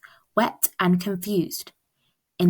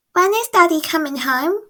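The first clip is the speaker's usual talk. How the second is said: put-on high, eager small girl asking her mum; little girl voice; 9 semitones higher than usual. Francie asks Mum about her sister, high child voice. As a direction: lift into a bright child's voice.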